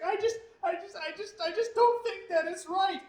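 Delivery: high, loud, weepy whine of a crying wife; mock sobbing wife. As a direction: blubber it out like a tearful wife. Wife crying during argument.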